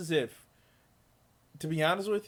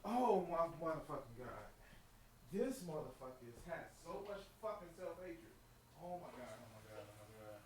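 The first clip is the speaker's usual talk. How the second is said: far off-mic; 2 semitones lower, 17 dB quieter than usual. Real, not acted. Off-mic horrified reaction.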